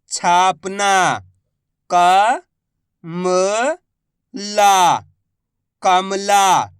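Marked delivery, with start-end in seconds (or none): 1.9-5.0 very slow, each syllable drawn out. Shown as a demonstration. Teacher sounding out a word for pupils, slow syllables. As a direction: spell the word out slowly for a child. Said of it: "मैं किस तरीके से".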